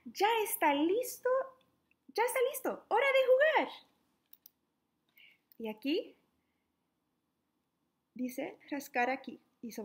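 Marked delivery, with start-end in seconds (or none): 0.0-3.7 rising high and louder with glee; excited. Acted. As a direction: build up the thrill of playtime. Excited reading of story.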